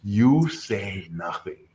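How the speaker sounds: slow, measured mock pronouncement; weighty verdict. Reviewer telling a student off, slow weighty verdict. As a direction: imitate a stern judge, stretching each word.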